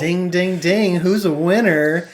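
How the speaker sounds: hammed-up prize announcer, drawing out the question; mock game-show host. Host crowning the player who guessed right, mock game-show host.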